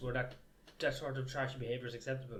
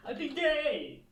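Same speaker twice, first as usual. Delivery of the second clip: mock plea squealed very high; 12 semitones higher, 4 dB louder, much slower than usual. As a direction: squeak it out jokingly.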